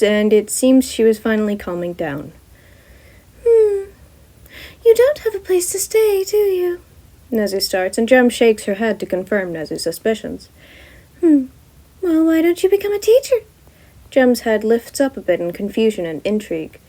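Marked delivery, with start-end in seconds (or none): 3.4-6.8 high, bright-voiced principal; Nezu voice. Nezu asking Gem about housing, high character voice. 11.2-13.4 high, bright-voiced principal; Nezu voice. Nezu asking Gem about housing, high character voice.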